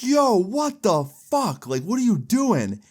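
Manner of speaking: shocked protest. Retold or imitated. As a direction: call out in disbelief at an attacker. Acted protest while being attacked.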